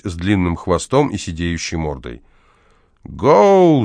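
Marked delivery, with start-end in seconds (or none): 3.0-3.8 high, loud drawn-out cry; shouting at horse. A narrator's reading. Neighbour yelling at the horse in his room, shouting. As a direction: holler a long command at a horse. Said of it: "закричал Шаринов на лошадь".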